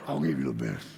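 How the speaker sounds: very deep, growly shop-guy voice; mock tough salesman. Shop guy challenging the customer, mock tough. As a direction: drop way down into an exaggerated macho rumble.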